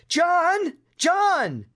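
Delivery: high-pitched alarmed cry; frightened scream. A narrator's reading. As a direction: call out high in alarm for a brother in danger. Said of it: "Lewis screamed".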